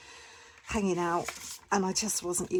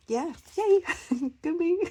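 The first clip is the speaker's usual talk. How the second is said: much higher and louder yay with a laugh; self-cheer; 11 semitones higher, 7 dB louder than usual. Real, not acted. Cheering herself on to viewers, giddy and loud. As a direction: celebrate yourself, bubbling over. Cheering her own savings.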